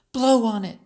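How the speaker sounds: put-on hurting girl insisting; insistent. A narrator's reading. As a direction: press her friend to blow on the wound. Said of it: "she insisted".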